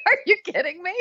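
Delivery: high laughing cry of disbelief. Real, not acted. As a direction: crack up in disbelief.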